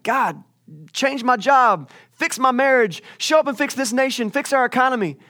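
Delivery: high, insistent pleading at God; hammed-up list of demands; demanding prayer. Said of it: "We pray for stuff all the time".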